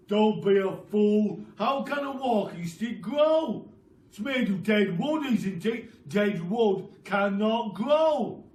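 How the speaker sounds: loud, slow scornful lecture; scornful Mr Twit. Acted. Husband scornfully lecturing his wife, loud. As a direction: talk down to her like a fool.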